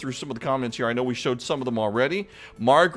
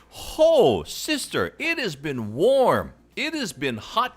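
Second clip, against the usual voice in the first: excited local greeting; 5 semitones higher, slower than usual. Real, not acted. Host hailing a viewer from Kapolei with excitement. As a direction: sing out the greeting.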